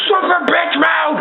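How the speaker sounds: high-pitched gamer yell; excited battle shout. Real, not acted. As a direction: yell it high in the heat of a fight. Battle outburst during gameplay.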